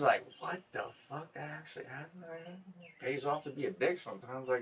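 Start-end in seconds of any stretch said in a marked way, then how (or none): 0.4-2.2 hushed, higher-pitched disbelief; re-enacted stunned near-whisper; stunned surprise. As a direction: marvel softly, as if to yourself. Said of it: "I was so surprised".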